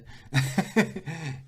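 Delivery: hearty burst of laughter. Real, not acted. Footballer amused by the interviewer's story, laughing. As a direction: laugh out loud at the joke. Joking about the chopped goal.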